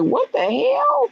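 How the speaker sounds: high, slow mock-confused husband; hammed-up husband's alarm; imitating husband. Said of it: "my husband be like".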